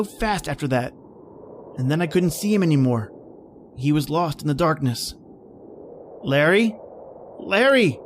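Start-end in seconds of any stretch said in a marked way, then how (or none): 6.2-8.0 rising call into the dark. Acted. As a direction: search for him blindly. Calling into darkness.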